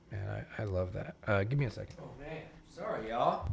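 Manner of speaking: real emotion, barely holding it together; choked up. Real, not acted. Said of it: "I got a little emotional".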